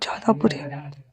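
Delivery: soft husky whisper, almost no voice. Retold or imitated. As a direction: breathe it close to his ear. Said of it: "एकदम husky, sexy tone में कहता है".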